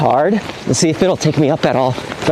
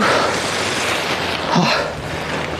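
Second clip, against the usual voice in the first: tired sigh; 5 dB quieter than usual. Real, not acted. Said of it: "That's exhausting".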